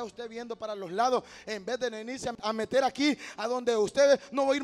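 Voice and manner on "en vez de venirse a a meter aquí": ordinary delivery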